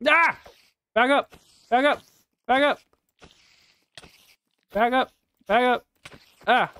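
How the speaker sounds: high frantic shouts of back up; panicked yelling. Real, not acted. Yelling at a spider attacking in the game, panicked shouts.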